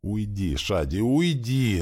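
high cry, slow and drawn out; screamed dismissal. A narrator's reading. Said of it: "закричала женщина".

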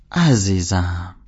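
slow, drawn-out brush-off; dismissive darling. Acted. Sally cutting off Holden's rant with a slow dismissive endearment. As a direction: stretch it out to change the subject. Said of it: "یعنی کانالو عوض کن".

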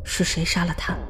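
normal talking voice

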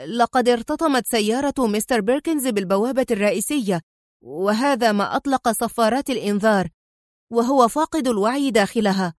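ordinary delivery, nothing marked

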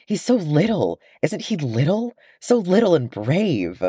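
high, delighted gush over "so little"; young dwarf Kili gushing to his brother, high and delighted. A narrator's reading. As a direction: coo over the tiny hobbit.

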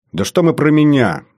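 mildly flustered, lifted exclamation; perking up. Acted. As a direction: brush the praise aside with sudden energy. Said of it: "вскинулся новоявленный старший лейтенант".

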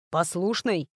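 slightly distrustful echo; doubtful. A narrator's reading. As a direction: repeat it with mild disbelief. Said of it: "слегка недоверчиво переспросил телохранитель".

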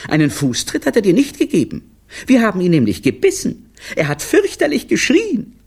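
put-on high, loud, excited kid voice; children calling out. A narrator's reading. Children telling what they did, high and excited. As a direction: blurt it out like proud children. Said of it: "riefen da seine Kinder".

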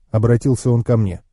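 ordinary delivery

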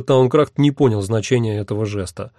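plain reading voice

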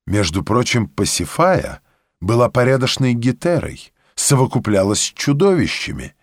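usual speaking voice

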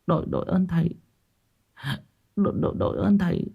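stammering gratitude. Acted. Stammering thanks to the master.

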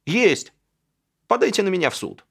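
loud defiant shout. A narrator's reading. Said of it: "и громко крикнул". (громкий вызывающий выкрик)

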